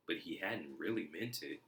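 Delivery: plain reading voice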